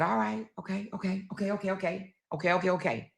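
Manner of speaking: soft, rapid string of okays; grudging giving-in. Retold or imitated. Mother giving in after fighting the move, softly relenting. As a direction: drop the volume and relent, okay after okay.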